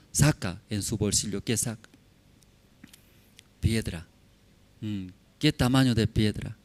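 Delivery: normal talking voice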